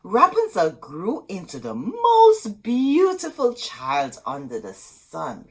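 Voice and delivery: plain reading voice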